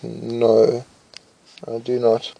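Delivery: ordinary delivery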